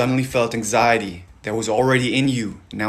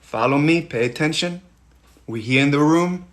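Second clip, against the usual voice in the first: ordinary delivery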